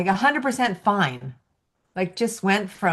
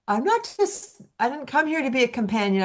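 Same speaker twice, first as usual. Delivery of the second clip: much higher, quick indignant protest; high dog voice; 6 semitones higher than usual. Retold or imitated. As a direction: speak as the upset little dog. Voicing the dog's protest.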